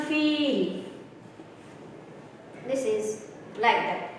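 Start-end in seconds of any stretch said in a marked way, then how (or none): 0.0-0.8 "feel" with a drawn-out ee; long vowel in feel. Shown as a demonstration. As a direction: hold the ee in "feel".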